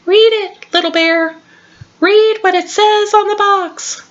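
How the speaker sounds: high, squeaky little mouse; tiny mouse voice. Acted. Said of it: "a tiny voice from the doorway said".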